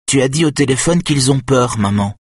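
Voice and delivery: put-on a little boy proving his point; earnest young boy. A narrator's reading. Son reminding his mother what she said, childlike.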